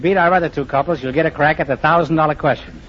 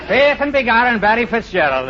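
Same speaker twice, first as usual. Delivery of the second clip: hammed-up stage Irish accent, pitched higher, laughing through it; mock Irish brogue; 8 semitones higher than usual. Host greets the Irish bride in a joking stage-Irish brogue. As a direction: lay on a comic Irish brogue like Barry Fitzgerald. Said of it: "How's my brogue?".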